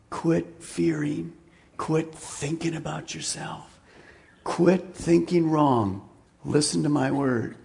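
soft, slow, tender; gentle quiet voice. Acted. God gently telling Elijah to stop fearing, softly. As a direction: speak it gently and slowly. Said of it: "a still small voice".

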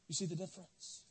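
soft, low, pressing the point home; near-whispered repeat. Real, not acted. Preacher hushing to the congregation, very quiet. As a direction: drop it to a hush the second time.

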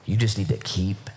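low, slow and drawn out; grave counsel, deep and unhurried; solemn advice. Retold or imitated. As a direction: weigh each word gravely. Recalling a man's grave advice to him.

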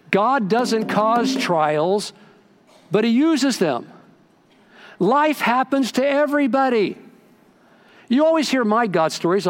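high, ringing pulpit delivery; emphatic preaching. Acted. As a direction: drive each point home to the congregation. Preaches with raised voice.

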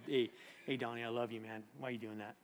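very soft, low, tender chiding; God's gentle voice. Acted. God correcting Donnie with love, soft and gentle. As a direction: murmur it lovingly, no scolding. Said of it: "he always says to me".

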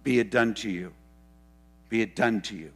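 solemn blessing. Retold or imitated. Jesus voiced granting request.

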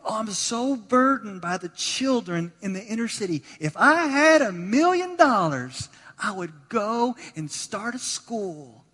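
high, loud, hammed-up longing; burdened lady, imitated. Pastor retelling a lady's inner-city school wish, high exaggerated longing. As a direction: gush with mock burden, slowing into the wish. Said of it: "a lady, and she said".

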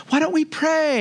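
lifted high, mock-sweet fiancee voice; fiancee's gentle suggestion.

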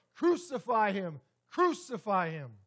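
loud, slow crowd shout; slow, loud chant of the crowd; crowd's cry. Retold or imitated. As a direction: cry it out like a baying mob. Crowd crying out again.

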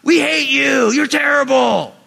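loud, high jeering from the crowd; mocking fans shouting insults at the cardboard player. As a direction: yell it like angry fans booing a traitor. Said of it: "all these people took the mic and like".

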